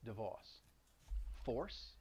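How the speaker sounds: "divorce" and "force" with the r dropped, long aw; soft Boston divorce, force, no r. Shown as a demonstration. Teacher modelling Boston r-dropping in divorce, force. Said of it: "in individual words like divorce".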